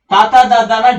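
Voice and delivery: forceful dental త, త, ద, ద, న; dental sounds. Shown as a demonstration. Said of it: "పంటి కిందికి రావాల్సిందే". (త, త, ద, ద, న దంత్యాలుగా, గట్టిగా)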